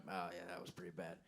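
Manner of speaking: a hint of embarrassed self-talk; mumbled aside. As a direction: mutter it to yourself after the joke flops. Awkward silence after a bad joke.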